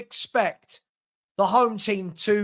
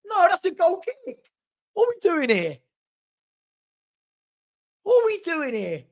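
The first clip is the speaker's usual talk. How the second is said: incredulous; 8 semitones higher than usual. Real, not acted. Disbelief at the referee's call.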